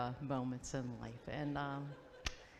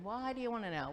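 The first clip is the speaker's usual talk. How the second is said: higher-pitched imitation of a wary reply; mock suspicious question; 8 semitones higher, faster than usual. [name] to his crush's go-between, mock-suspicious. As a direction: toss back the question in a raised voice.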